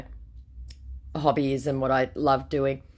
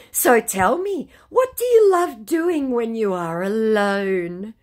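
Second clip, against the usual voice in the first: higher, louder, slow syrupy question; mock scammer question; 9 semitones higher, 7 dB louder, slower than usual. Scammer asking his stock question, slow mock sweet. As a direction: drawl the canned question sweetly.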